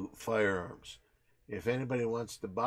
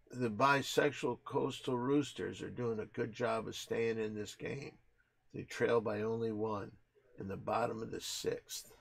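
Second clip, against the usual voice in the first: ordinary talk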